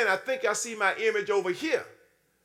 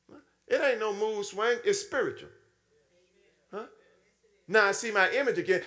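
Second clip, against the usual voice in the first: usual speaking voice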